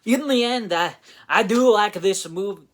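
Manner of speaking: loud, high put-on Scottish accent. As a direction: belt it out in a mock Scottish accent. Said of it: "I gotta do a Scottish accent now".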